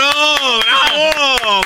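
high, stretched-out cheer; mock bravo. Cohost mockingly applauding the host, high stretched cheer. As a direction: call out a drawn-out teasing bravo.

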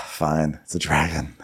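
low, soft, deflated delivery; resigned sigh. Acted. Swordsman giving up before a dragon, resigned. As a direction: sigh first, then give in low and quiet. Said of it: "and someone going".

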